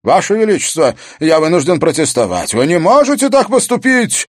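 loud, very high outraged objection; aghast, escalating protest to the king; stunned protest. A narrator's reading. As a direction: cry out in disbelief, climbing to a shout. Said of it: "совершенно оторопевший, устремился вперёд".

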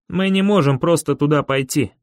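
usual speaking voice

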